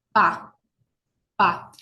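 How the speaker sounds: Pa with lips closing, no voice; crisp unvoiced Pa for students to watch; p sound demo. Shown as a demonstration. Teacher showing lips touching on Pa. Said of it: "Fíjense cómo los labios se tocan".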